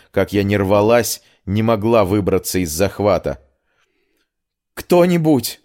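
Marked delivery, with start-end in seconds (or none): 4.7-5.6 high-pitched cry for help; panicked shout into an empty room. A narrator's reading. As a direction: call out desperately for anyone. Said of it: "крикнула я".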